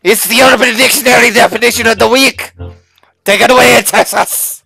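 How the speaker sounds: high, loud game-show announcer. Acted. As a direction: announce it like a TV host. Announcer intro of segment.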